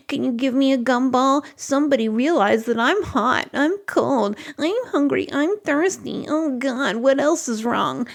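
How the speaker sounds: fussy whiner. Acted. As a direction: imitate a needy baby listing every discomfort. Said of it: "when they're acting like that".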